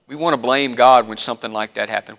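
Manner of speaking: ordinary delivery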